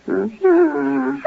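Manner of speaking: normal talking voice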